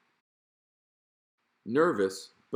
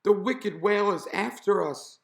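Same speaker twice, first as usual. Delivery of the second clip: high, loud panicked warning; alarmed call; 12 semitones higher, 6 dB louder than usual. A narrator's reading. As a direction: keep yelling the alarm up high. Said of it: "he called".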